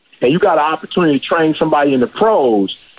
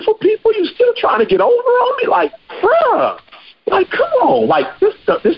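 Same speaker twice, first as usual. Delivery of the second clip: exasperated high outburst. Real, not acted. Host venting to listeners about being cheated, exasperated and high. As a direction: pitch shoots way up, disbelieving. Frustrated at people cheating him.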